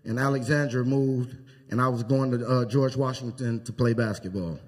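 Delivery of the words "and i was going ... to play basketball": ordinary delivery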